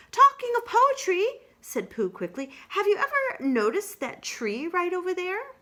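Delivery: quickly change the subject; Pooh voice. Acted.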